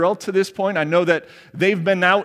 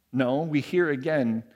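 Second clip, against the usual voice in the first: ordinary delivery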